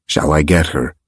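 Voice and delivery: low, level offer; calm. A narrator's reading. Axe offers to chase the girl, calmly. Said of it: "Axe asked calmly".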